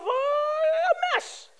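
squeaky high strained "a mess"; mock parent grudgingly confessing; reluctant admission. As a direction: squeeze it out high, like it hurts to admit. Parent admitting kid is trouble.

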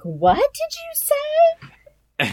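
high, slow shocked mom voice; a mother who can't believe her ears, drawn out; mock horrified mom.

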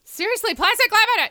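loud, high, outraged; exasperated shout. Real, not acted. Friend venting loudly about the designer's mistake. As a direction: yell it in disbelief.

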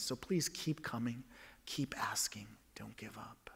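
soft pleading whisper; whispered plea. Real, not acted. Pastor urging doubters, whispered. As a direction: breathe it out, begging them not to quit.

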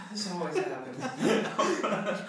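high, slow comic lament with laughter; drawn-out, high mock complaint; lamenting merchant. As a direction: bemoan your luck, laughing through it. Merchant figure laments lost sale, laughing.

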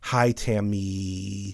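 slow, Tammy with its last syllable held long; slow mock greeting, Tammy stretched out; imitating stretched name. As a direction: drag out the end of Tammy. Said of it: "he would extend the last syllable of it".

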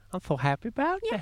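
very high put-on squeak; hammed-up happy little voice; mock gushing. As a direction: squeal it in pretend delight.

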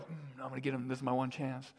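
hushed, scheming mock aside; scheming Paul. Pastor voicing Paul scheming against his readers, hushed. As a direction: plot it under your breath. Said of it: "like, way to, like".

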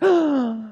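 sharp high gasp of surprise; a showy gasp to illustrate surprise; demo gasp. Shown as a demonstration. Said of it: "there's no Greek word that goes".

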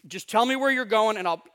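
loud, pushy request for an address; lightly voiced disciple wanting directions; impatient demand. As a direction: disciple pressing Jesus for the address. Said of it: "they're like".

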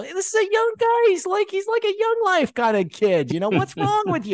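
high, pressing inner rebuke; high-pitched chiding inner voice; inner scolding voice. Retold or imitated. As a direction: let the inner voice nag him. Holy Spirit's inner voice scolding.

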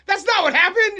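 high, loud correction; imitated heckler's objection. Friend in the audience disputing the story, loud mock objection. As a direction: call it out over the storyteller.

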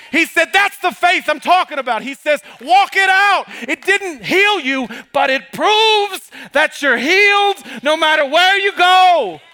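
high, loud preaching, climbing to a shout; rising Pentecostal sermon cadence; full-force preaching. Acted. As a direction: preach it up to the rafters.

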